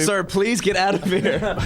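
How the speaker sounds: high, drawn-out plea through laughter; mock pleading. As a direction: play an exasperated staffer, half laughing. Acting out staff ejecting a volunteer.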